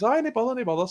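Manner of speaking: high-pitched, too-casual brush-off; retold casual reply. Mate feigns casualness to his mum.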